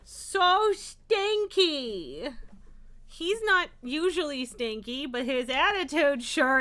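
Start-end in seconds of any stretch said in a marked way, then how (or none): 0.0-2.3 very slow, stretched-out tease; drawn-out teasing. Real, not acted. Owner teases her stinky-tempered cat, very slow drawn-out tease. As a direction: drag it out long and high to tease.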